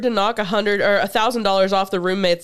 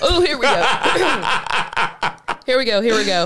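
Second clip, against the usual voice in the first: dramatic anticipation; 2 semitones higher than usual. Real, not acted. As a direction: build suspense with a laugh. Dramatic cue for twist.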